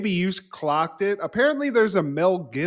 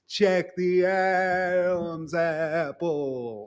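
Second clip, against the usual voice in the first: 1 drawn-out sly warning; much slower than usual. Retold or imitated. Martin's sly warning to Tommy.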